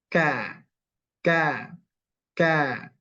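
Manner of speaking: ka with tongue slightly back on the hard palate; correct kaf. Shown as a demonstration. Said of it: "kalau kaf dia agak ke tengah sedikit".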